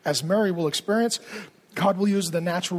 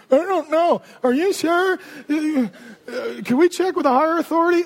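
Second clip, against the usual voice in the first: put-on doubting old man; 6 semitones higher, 4 dB louder than usual. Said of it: "Zechariah doubts the angel".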